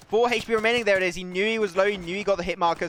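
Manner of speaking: loud, high commentary easing down gradually; animated esports caster call; excited play-by-play. Real, not acted. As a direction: burst in as the duel lands. Live play-by-play of a duel.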